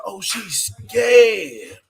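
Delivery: slow gleeful mocking about her fear; laughing mockery. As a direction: stretch it out while cracking up. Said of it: "I said".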